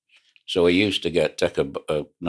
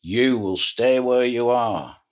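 slow, higher, loud announcement over a tannoy; booming tannoy order; 7 semitones higher, 3 dB louder, slower than usual. Retold or imitated. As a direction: boom it out like a loudhailer across the water. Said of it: "he came over this tannoy".

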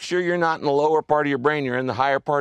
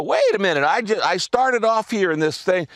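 higher-pitched mock self-interruption; acted self-check; 6 semitones higher, 3 dB louder than usual. Teaching listeners to catch themselves mid-argument, acted self-check. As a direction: catch yourself suddenly, pitched up. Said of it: "you can learn to go".

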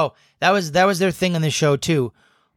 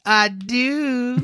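high Kel voice; 10 semitones higher than usual. Retold or imitated. Answering as Kel.

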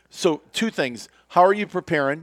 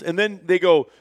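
ordinary delivery

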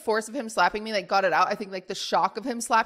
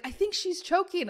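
high, softer mock-panicked voice; imitated worried alert; 8 semitones higher, 4 dB quieter than usual. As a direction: imitate a worried bystander, pitched up. Panicking girl alerts her boyfriend.

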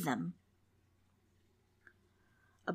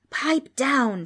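higher, louder shriek; ghostly shriek; 6 semitones higher, 6 dB louder than usual. A narrator's reading. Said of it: "shrieked the ghost".

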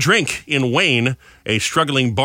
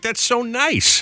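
put-on high, very slow Southern accent; mock Southern accent; 11 semitones higher, much slower than usual. Husband mimicking mother-in-law's phone reply in a Southern accent. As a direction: stretch it out like a sugary Southern lady. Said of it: "Why do you always give her, like, a Southern accent?".

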